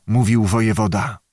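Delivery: usual speaking voice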